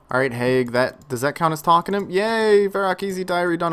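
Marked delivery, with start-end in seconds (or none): 2.1-3.7 higher, louder cheer of relief; happy cheer. Real, not acted. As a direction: cheer it out like a small win. Celebrating a finished diary.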